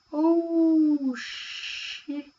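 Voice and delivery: the dawning horror of a fighter facing a room of ghouls; shocked realization. Retold or imitated. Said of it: "he realizes".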